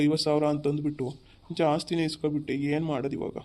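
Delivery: ordinary talk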